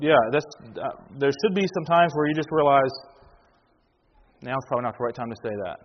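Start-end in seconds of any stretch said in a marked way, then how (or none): 4.4-5.8 low, soft, quick aside to self; muted inner thought. Acted. Man telling himself to hold back, muted. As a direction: think it under your breath, quickly. Voices a restraining thought to oneself.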